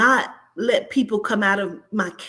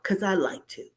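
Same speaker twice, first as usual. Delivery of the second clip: whisper; 3 semitones lower, 5 dB quieter than usual. Real, not acted. Aside whispered.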